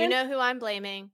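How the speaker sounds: ordinary delivery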